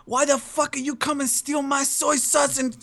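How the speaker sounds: high-pitched, hammed-up mock Chinese accent; Mister Chang. Prank-calling a friend as a character, mock Chinese accent. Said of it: "I really love doing Mister Chang".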